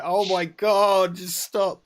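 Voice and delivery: high, loud, drawn-out exasperated plea. Acted. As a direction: wail it slowly in mock despair. Acting out exasperation at repeated misinformation.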